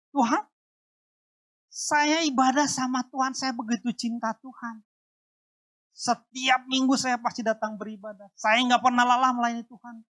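aggrieved questioning of God; accusing plea to God. Retold or imitated. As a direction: demand answers from God. Retold complaint to God.

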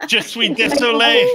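high, hammy French apology; French accent. Pretend. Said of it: "What did you say afterwards?".

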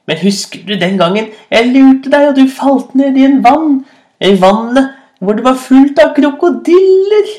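high, loud, excited rabbit; gleeful taunting. A narrator's reading. Rabbit reminding hyena of crocodile trick, gleefully taunting. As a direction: climb high as you tease.

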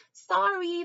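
high, loud quick apology; hasty squirrel. Acted. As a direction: toss the apology over the shoulder while fleeing. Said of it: "the squirrel says to me".

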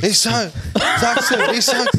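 high excited blurting; eager caller. Retold or imitated. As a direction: blurt it out, desperate to answer. Imitating eager game caller.

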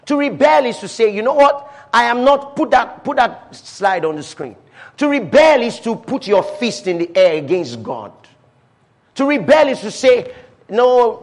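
loud, high, urgent sermon delivery; full-force preaching. Acted. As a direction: preach it hard from the pulpit.

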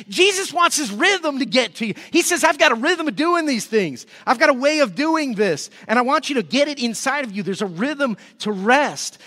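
preacher pleading with the tired crowd; fervent preaching. Acted. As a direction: press the message home with fire. Preaching as Jesus.

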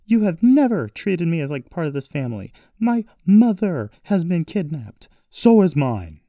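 high, loud, indignant fury; furious outburst. A narrator's reading. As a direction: hurl it out, high and loud with hard-hit words. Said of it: "She was so furious".

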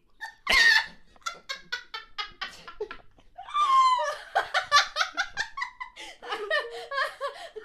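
shrieking high laughter; loud burst of laughter. Real, not acted. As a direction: cackle out of control. Bursts out at hipster beanie.

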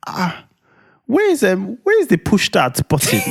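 high-pitched, hammed-up searching voice; mock confused partner. Husband mimics wife looking for the push start, mock confused. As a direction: imitate her hunting about, voice climbing high. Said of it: "she's probably looking around like".

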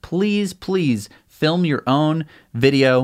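ordinary talk

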